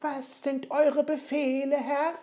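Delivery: hohe, laute Zwergenstimmen; rufende Zwerge. Acted. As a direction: eifrig dienende Zwerge rufen ihrem Herrn zu. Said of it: "Und die Zwerge riefen aus". (die Zwerge rufen Ofenmichel nach Befehlen, hoch und laut)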